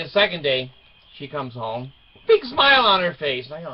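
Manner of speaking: ordinary talk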